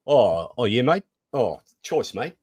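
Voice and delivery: hammed-up New Zealand accent. Shown as a demonstration. Host shows co-host his New Zealand accent, playfully put on. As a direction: put on a casual Kiwi accent. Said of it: "in my New Zealand accent".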